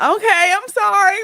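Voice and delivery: high, loud exaggerated sorry; mock apology. To teasing neighbor kids, loud mock apology.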